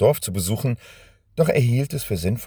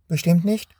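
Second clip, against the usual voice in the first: put-on high, timid little girl asking; frightened question; 9 semitones higher than usual. Scared girl asking Benjamin if he means it, timidly. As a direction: ask anxiously for reassurance. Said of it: "fragte das Mädchen verängstigt".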